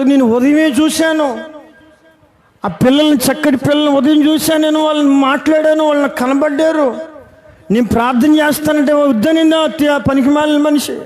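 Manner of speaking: put-on distressed pastor mourning the girl he saw that morning; retold grief. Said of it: "ఆయన బాధపడిపోయి".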